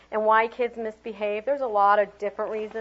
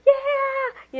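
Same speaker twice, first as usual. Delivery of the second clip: high loud cheer; cheering yeah; 7 dB louder than usual. Shown as a demonstration. Teacher modelling a cheer for the kids, excited. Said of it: "kids cheering demo".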